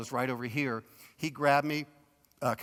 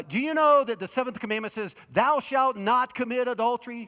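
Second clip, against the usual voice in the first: high, loud stern rebuke; 8 semitones higher, 6 dB louder than usual. Pretend. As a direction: call out the law sternly and loud. Jesus could have rebuked her.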